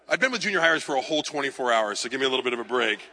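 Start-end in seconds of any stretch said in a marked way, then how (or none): none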